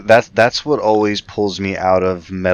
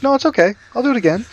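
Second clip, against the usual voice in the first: put-on very high little-kid voice; playful falsetto impression of a kid; imitating carefree kids; much faster than usual. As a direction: chirp it like an unbothered child. Kids blithely answering dad.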